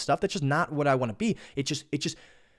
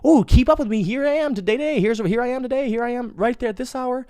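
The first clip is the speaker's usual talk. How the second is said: mock Snapchat show-off; 9 semitones higher than usual. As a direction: chirp it like a preening influencer. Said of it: "And Snapchat's like".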